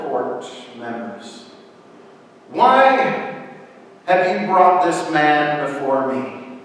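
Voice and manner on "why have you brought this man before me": loud, raised questioning as Pilate; commanding demand. A narrator's reading. Pilate questions those bringing the prisoner, loud and commanding. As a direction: demand an answer from the accusers.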